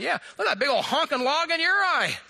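high, exclaimed comeback; mock indignant retort. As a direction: throw the accusation back, hammed up. Spouse's retort in acted quarrel.

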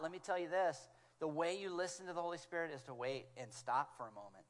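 ordinary talk, nothing marked

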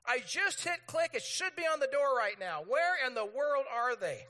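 mock outrage at a late delivery; indignant customer, hammed up; impatient demand. Acting out impatient Amazon customer.

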